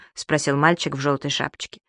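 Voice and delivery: ordinary delivery, nothing marked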